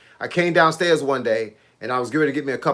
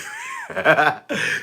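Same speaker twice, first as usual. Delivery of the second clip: high burst of laughter; 3 dB quieter than usual. Real, not acted. Cracking up at his own joke.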